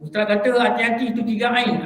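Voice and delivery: ordinary talk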